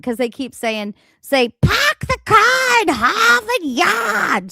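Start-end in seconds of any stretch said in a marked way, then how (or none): 1.6-4.5 slow, high mock Boston accent "Park the car in Harvard Yard" with dropped r's. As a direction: drawl it out in a hammed-up Boston accent.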